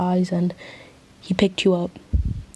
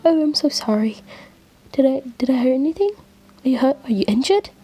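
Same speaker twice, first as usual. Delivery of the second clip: higher, worried apology; flustered concern; 6 semitones higher, faster than usual. Acted. Angel worried he hurt you with a hug, flustered. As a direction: fuss anxiously with quick questions. Worried he squeezed listener too hard.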